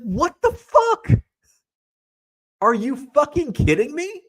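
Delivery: high, loud, stretched-out incredulous outburst; reliving the shock at the dealer; outraged disbelief. Retold or imitated. To the dealer who sold the card, outraged disbelief.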